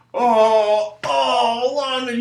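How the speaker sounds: loud, high mock wail; mock crying. Kids hearing about long division, loud mock crying. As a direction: wail it like an overdramatic kid. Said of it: "Usually kids start crying".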